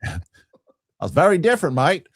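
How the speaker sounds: high, fast, badly faked Australian accent; terrible Australian accent. After fumbling the word Australian, a hammed-up terrible Australian accent. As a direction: ham up a cheesy Aussie voice, mate and all. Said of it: "that's a terrible Australian accent".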